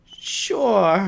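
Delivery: high, bright "Sure" from the spider; squeaky spider reply. Pretend. Spider casually accepting the bats' crown, high and loud. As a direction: toss off a high, easy yes. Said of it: "And they're like".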